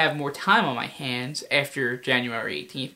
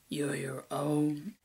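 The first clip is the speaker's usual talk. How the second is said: slow, much softer, rising at the end; hushed villain line; 3 semitones lower, 11 dB quieter, much slower than usual. Acted. Villain tries the line slowly and hushed in a retake. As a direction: murmur it slowly.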